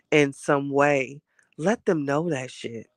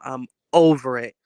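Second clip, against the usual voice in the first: firm, fed-up complaint with a falling end, a bit louder; over it, put it down with a drop; 6 dB louder than usual. Real, not acted. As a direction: done with being sick, said flatly. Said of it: "I'm so over this cold".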